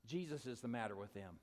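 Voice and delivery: low, soft, weighty reveal; solemn answer. Retold or imitated. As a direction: state it quietly with conviction. Retold sponsor's weighty answer.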